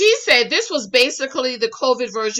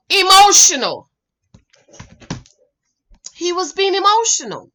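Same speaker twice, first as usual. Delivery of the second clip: "emotional" said high and loud, falling; stressed emotional; 7 semitones higher, 5 dB louder than usual. Real, not acted. Telling the viewers Miles was just emotional, stressed. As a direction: lean on "emotional" to drive it home.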